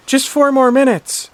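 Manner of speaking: put-on high, loud, slow child begging; whiny kid plea. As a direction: plead like a little boy stalling bedtime. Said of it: "With Junior saying".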